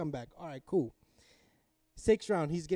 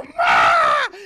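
high, loud excited yell; calling for mom; 11 semitones higher, 15 dB louder than usual. Retold or imitated. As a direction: re-enact shouting through the house. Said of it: "I ran to my mom".

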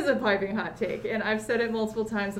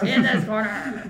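wrestling ring announcer, mild hype; 5 dB louder than usual. Pretend. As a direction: call it out like a ringside announcer. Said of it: "pro wrestling sound effects".

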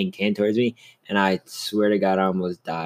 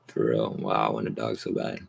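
ordinary talk, nothing marked

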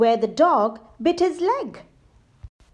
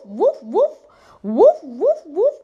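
loud barking woofs; loud dog barks; 4 semitones higher, 8 dB louder than usual. Acted. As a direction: bark like a dog on the attack. Animals charge the robbers.

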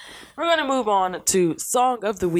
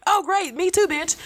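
sarcastic retort; 10 semitones higher than usual. Retold or imitated. Sarcastic retort, pitch jump.

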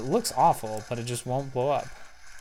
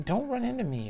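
mock protest; 11 semitones higher than usual. Real, not acted. Yelling at game cars.

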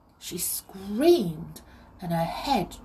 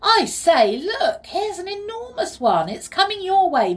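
high, loud call of discovery; excited calling out; 13 semitones higher, 8 dB louder than usual. A narrator's reading. Peter alerts Molly to an enormous one, excited call. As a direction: call out urgently, pitched up. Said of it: "Peter called out".

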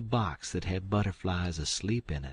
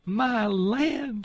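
very high, loud cry; delighted surprise; 6 dB louder than usual. Acted. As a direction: exclaim in joyous shock. Aunt Sally recognizes Sid.

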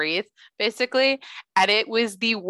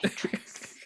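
whisper; 11 dB quieter than usual. Pretend. Echoing the recurring Matrix joke.

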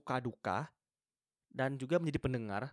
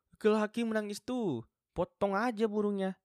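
mocking sneer; 9 semitones higher, 4 dB louder than usual. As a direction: sneer it like a know-it-all who mocks crying men. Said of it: "orang-orang yang sok-sok ngomong kayak".

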